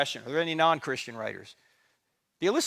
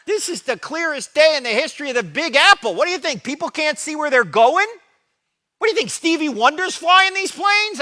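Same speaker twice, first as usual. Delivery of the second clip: much higher, loud scornful tirade; mocking the commentator at full force; indignant rant; 12 semitones higher, 13 dB louder than usual. Speaker yelling back at the TV commentator, scornful and loud.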